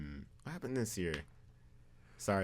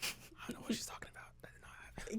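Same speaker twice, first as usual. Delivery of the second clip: whispered aside to the room; 11 dB quieter, faster than usual. Real, not acted. Denying the story to the listeners, whispered. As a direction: hush it like a secret denial.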